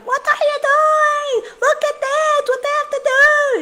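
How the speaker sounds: imitated crowd screaming at a mother; screaming bystanders. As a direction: shriek at the mom like a panicked onlooker. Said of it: "people were just screaming at her".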